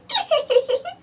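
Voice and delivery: put-on high child giggle; a short happy giggle; little piglet giggle. Peppa giggling at Mummy's answer, high giggle. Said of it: "Peppa giggles".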